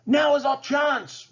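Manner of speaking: high, slow villainous order; commanding. A narrator's reading. Ratcliffe ordering his men to attack, commanding. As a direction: seize the moment. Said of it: "Ratcliffe commanded".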